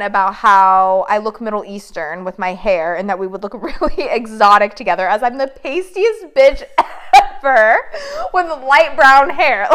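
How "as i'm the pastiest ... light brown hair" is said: laughing through the words, slowed down; giggling disbelief at the idea, slowed down. Real, not acted. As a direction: crack up while describing herself. Laughing at her own pale looks.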